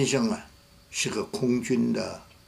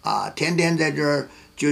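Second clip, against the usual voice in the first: normal talking voice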